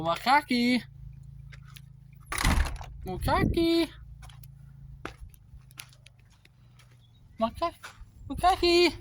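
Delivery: high sing-song call. Real, not acted. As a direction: call him from the next room. Calling the brother by a nickname.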